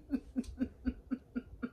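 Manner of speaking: breathless silent laugh, shaking with it; real laugh. Real, not acted. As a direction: burst out laughing at the memory. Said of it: "I don't know why that was so funny to me".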